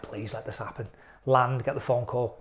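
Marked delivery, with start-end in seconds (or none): 0.0-0.9 low, soft wishful plea; hushed plea. Retold or imitated. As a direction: say it under your breath like a prayer. Retelling private plea during the wait.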